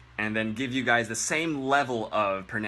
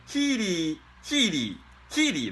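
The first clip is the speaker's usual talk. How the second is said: higher, chirpy Healy repeated; mock squeaky Healy; 8 semitones higher than usual. Shown as a demonstration. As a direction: chirp Healy three times mockingly. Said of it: "That's what it sounds like".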